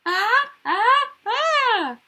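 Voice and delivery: high, loud, comic screaming; startled scream. Acted. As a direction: scream out in alarm.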